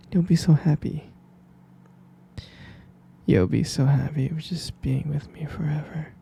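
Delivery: usual speaking voice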